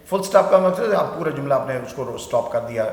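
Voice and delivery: ordinary talk, nothing marked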